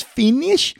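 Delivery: high, loud questioning lady; masseuse voice. Retold or imitated. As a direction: mimic the masseuse asking. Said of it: "she goes".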